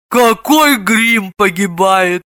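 long drawn-out anguished cry; slow, theatrical howl of grief; wailing yell. A narrator's reading. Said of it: "он завопил".

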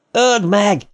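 very high, urgent cry; frantic high-pitched shout. A narrator's reading. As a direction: cry out the command, pitch shooting up. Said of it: "kiáltotta Ben".

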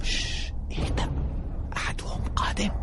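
hushed urgent whisper. Acted. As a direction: whisper so nobody hears. Hiding from someone approaching. (همس مستعجل)

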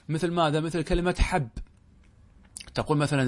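ordinary talk, nothing marked

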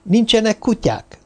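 suspicious. A narrator's reading. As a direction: ask it with narrowed suspicion. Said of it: "kérdezte gyanakodva".

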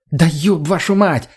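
acted furious outburst; angry roar. A narrator's reading. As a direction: roar it slowly in outrage. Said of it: "взревела она".

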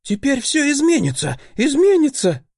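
high, rising to a near shout; joy spilling into tears; overjoyed. A narrator's reading.

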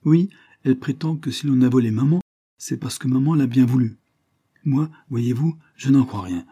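a hint of an insistent little girl; earnest little girl. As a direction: explain it earnestly, lifted and lively. Child continues her account.

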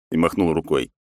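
ordinary talk, nothing marked

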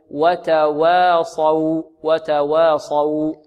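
"وتواصوا" slow, both waws light, saad heavy. Shown as a demonstration. Teacher demonstrates for students, slow "وتواصوا" with light waws. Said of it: "هكذا".

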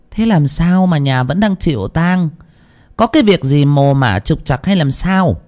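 a hint of a suspicious old seer; fortune-teller questioning. A narrator's reading. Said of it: "đoạn nói".

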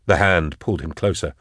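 plain reading voice

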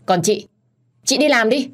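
sharp motherly rebuke; mild scolding. A narrator's reading. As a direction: order her off with a cross edge. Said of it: "quát nhẹ tôi".

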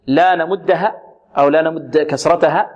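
ordinary talk

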